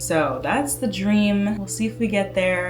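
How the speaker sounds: ordinary talk, nothing marked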